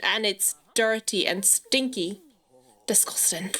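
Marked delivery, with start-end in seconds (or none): 2.8-3.5 soft, lower-key verdict; quiet disgust. Real, not acted. Gamer reacting to the dirty pottery place, quiet disgust. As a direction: say it low under your breath. First take of repeated reaction.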